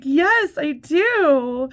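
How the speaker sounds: very high, loud eager yes; hammed-up gushing lady; mocking the lady.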